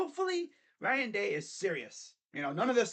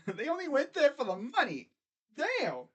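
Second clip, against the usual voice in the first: amused, higher-pitched laughing delivery; 7 semitones higher than usual. Real, not acted. Host mocking players who left for money, laughing. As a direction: laugh your way into the line, pitch up.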